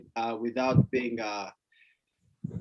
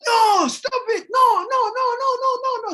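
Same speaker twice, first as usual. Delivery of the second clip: panicked parent shout; 6 dB louder than usual. Retold or imitated. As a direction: shriek no at the kid heading for the stairs. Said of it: "You go".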